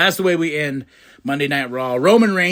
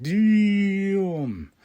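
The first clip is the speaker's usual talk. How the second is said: high, long-held exclamation; stunned reaction; 4 semitones higher than usual. Retold or imitated. Fan reacting to Seth's cutting line, drawn-out amazement. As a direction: stretch the word out, impressed.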